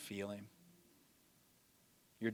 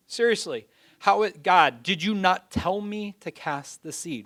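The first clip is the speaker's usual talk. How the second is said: anguished cry to God; 7 semitones higher, 4 dB louder than usual. Retold or imitated. Farmer yelling up at God after the storm, anguished. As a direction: cry out to heaven in disbelief.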